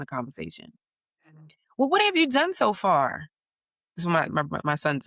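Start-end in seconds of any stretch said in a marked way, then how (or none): 1.8-3.3 put-on high, louder eager child voice; eager kid. Son quizzing his older cousin, high eager kid voice. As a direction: ask it like an eager little expert. Kids comparing trips.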